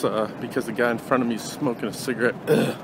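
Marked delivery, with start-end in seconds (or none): none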